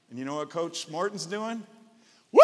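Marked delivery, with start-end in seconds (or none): 2.3-2.4 coach's whoop. Retold or imitated. Coach cheering touchdown.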